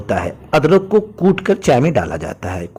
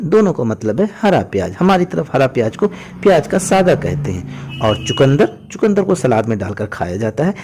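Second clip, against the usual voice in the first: usual speaking voice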